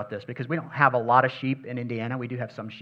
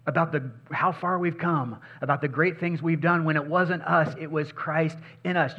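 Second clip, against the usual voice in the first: ordinary talk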